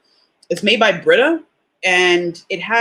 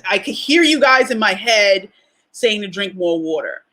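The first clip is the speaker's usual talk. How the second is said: ordinary delivery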